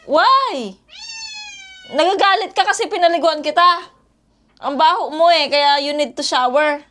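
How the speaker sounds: loud, high scolding shout; yelling at a noisy pet dog. Real, not acted. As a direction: snap at the dog to make it stop. Scolding dog after its bath.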